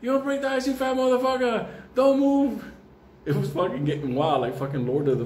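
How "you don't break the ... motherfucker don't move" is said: frantic yell. Retold or imitated. Friend yelling at man in ice, high frantic mock yell. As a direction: yell at him not to move. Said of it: "B D's like".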